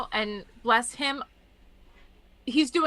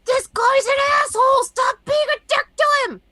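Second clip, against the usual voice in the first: shouting at the screen; 14 semitones higher, 6 dB louder than usual. Retold or imitated. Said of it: "you're sitting there going".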